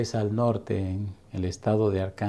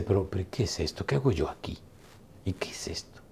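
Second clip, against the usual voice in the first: bewildered, softer, fading to a hush; confused waking; 3 semitones lower, 7 dB quieter than usual. Retold or imitated. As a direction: mutter in disbelief. Homeless man wakes confused.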